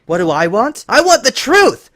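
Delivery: high, loud angry shout; furious demand. Acted. Twilight demanding the truth from Lyra, furious shout. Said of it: "Twilight said".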